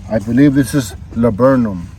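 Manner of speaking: ordinary talk, nothing marked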